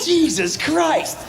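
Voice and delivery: high, very slow comic disbelief; appalled exclamation. Acted. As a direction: drag it out high, aghast. Amazed at nail pulled by teeth.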